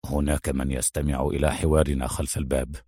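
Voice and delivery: low, soft warning; hushed voice. A narrator's reading. Adham warning Mona of an eavesdropper, in a hushed voice. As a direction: drop pitch and volume to warn. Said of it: "وقال بصوت خافت".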